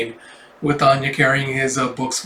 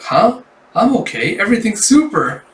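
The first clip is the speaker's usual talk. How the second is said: put-on higher, perky little-girl voice; oblivious cheerful child; 6 semitones higher than usual. Child reassuring housemaster, oblivious and chirpy. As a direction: brush off the worry, pitch lifting. Child answers teacher's concern.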